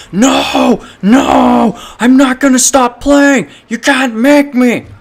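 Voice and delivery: high, loud shrieking refusal; screaming tantrum. Retold or imitated. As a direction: yell it while pacing with fists clenched.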